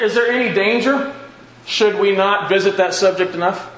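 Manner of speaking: normal talking voice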